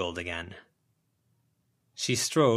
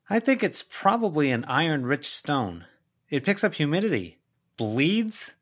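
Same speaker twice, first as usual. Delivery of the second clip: dismissive; 7 semitones higher, 4 dB louder than usual. Acted. Sharon dismissing it.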